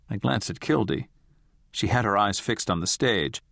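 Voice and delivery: ordinary delivery, nothing marked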